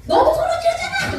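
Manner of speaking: very high mock-accusing comeback; hammed-up quarrel retort; acted yelling back. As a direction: play the other spouse firing back. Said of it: "그러면 상대편은 또".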